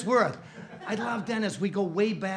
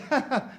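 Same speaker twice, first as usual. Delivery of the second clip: mother's laugh; 9 semitones higher, 5 dB louder than usual. Acted. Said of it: "The mother starts laughing".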